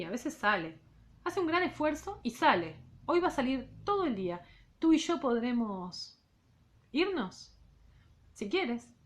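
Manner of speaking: measured literary read-aloud; reading a novel aloud to students; story narration. A narrator's reading. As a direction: read the novel to the class, unhurried.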